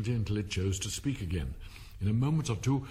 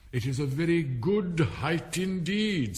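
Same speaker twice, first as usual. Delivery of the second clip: slow, raised indignation; angry; 6 semitones higher, 4 dB louder, slower than usual. A narrator's reading. Caterpillar defending its own height, slow and angry. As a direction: bear down slowly on each word. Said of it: "said the caterpillar angrily".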